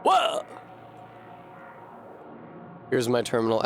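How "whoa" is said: sharp high startled whoa; surprised whoa. Real, not acted. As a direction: caught off guard, jump up high. Sudden surprise at game.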